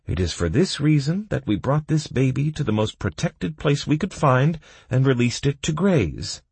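high-pitched mock courtroom plea; rattled-off speech. A narrator's reading. Prisoner quoting Sword's speech to the judge, rattled off. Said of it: "Karheim rattled".

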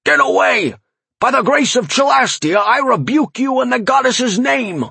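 high-pitched, strained exorcising cry; fervent rebuke. A narrator's reading. Farmer rebuking a warlock by the goddess, fervent cry. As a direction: banish the evil in a panicked cry.

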